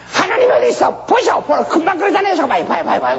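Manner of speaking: high, shouted taunting devil; shrill-pitched mocking accuser yelling look; mocking Satan.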